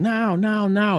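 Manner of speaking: high, urgent mock yell; retold yelling. Woman starting the shooting, yelling in the retelling. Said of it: "the woman just starts yelling".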